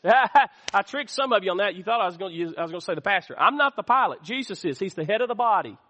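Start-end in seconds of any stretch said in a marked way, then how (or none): none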